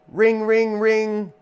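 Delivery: high, even ringtone mimicry; imitated phone ring. Phone interrupting a presentation, high imitated ringing. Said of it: "turn the ringer off on their cell phone".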